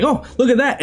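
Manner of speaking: lightly voiced, high-pitched casual discovery; casual browsing mother. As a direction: pipe up as a mother idly noticing something. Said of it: "the mother casually browsing".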